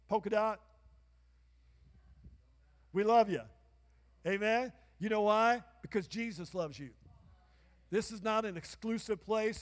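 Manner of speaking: loud sermon calling for amens; full-force preaching. Acted. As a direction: build it up, ring it out to the crowd. Preaching to congregation, calls for amen.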